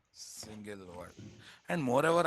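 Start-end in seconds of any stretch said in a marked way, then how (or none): 0.1-1.1 quiet answer. Shown as a demonstration. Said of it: "You write it as what?".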